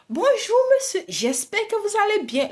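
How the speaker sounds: high, sweet mock-polite greeting; overly sweet shop greeting; sugary cashier greeting. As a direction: imitate the cashier's honeyed welcome.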